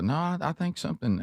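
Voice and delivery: a high imitated Sherry, fretting. Sherry insists she broke the chair, high mock voice.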